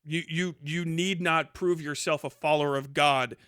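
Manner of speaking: ordinary talk, nothing marked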